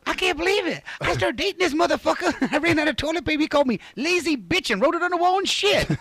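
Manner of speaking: very high squeaky falsetto; hammed-up woman telling on her date; mock outraged girlfriend. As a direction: wail a complaint in falsetto. Mocking an outraged date's complaint.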